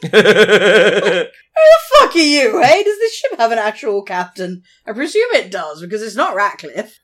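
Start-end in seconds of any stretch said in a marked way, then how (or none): 0.0-4.6 mock outraged yell. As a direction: bellow it like a fed-up sailor. Mock outrage at Smith commanding.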